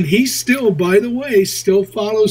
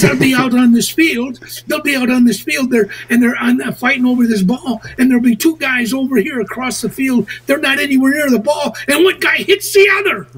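higher, building amazement, a lightly voiced Irish bar patron; retold bar patron; 6 semitones higher than usual. Irishman in a bar telling about American football, growing amazed. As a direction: climb in pitch as the story gets wilder. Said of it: "this other guy says".

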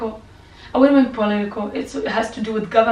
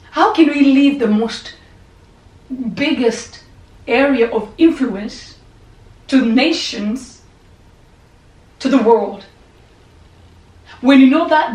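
forceful preaching; 2 semitones higher, 8 dB louder, slower than usual. Real, not acted. Preacher pressing the congregation hard.

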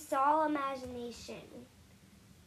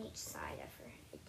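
whisper; 9 dB quieter than usual. Real, not acted. Whispered aside while styling.

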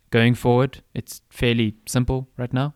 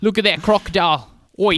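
put-on Australian accent; 7 semitones higher than usual. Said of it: "talks in an Australian accent".

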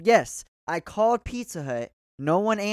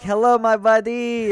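high, loud, slow Indian greeting; mock Indian accent; 6 semitones higher, 9 dB louder, slower than usual. Shown as a demonstration. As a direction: stretch out "Hello, my buddy" in a thick Indian lilt. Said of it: "there's a difference between".

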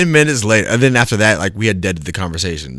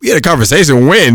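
loud, high incredulous question; indignant, raised-voice retort; baffled reply; 6 semitones higher, 6 dB louder than usual. Retold or imitated. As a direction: throw it back in disbelief. Acts out his baffled reply.

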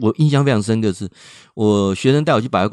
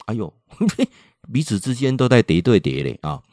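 normal talking voice

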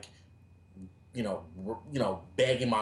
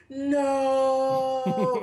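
high, loud drawn-out mock cry of no; dramatic mock wail; 7 dB louder than usual. Mocking the dramatic rejected type, hammed-up wail. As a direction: ham up a despairing no. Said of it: "never been the type like".

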